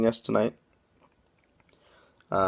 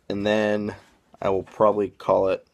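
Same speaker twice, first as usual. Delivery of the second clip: normal talking voice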